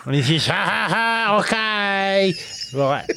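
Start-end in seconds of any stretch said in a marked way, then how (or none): 0.5-2.3 high fake laugh into a stretched okay; hammed-up, high-pitched mock laughing reply; mock gleeful owner. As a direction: imitate an over-agreeable waiter cackling. Imitating the gleeful restaurant owner.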